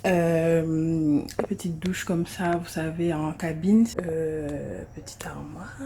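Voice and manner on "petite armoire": whispered. Real, not acted. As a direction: whisper it, unvoiced and soft. Showing the bathroom, trails off.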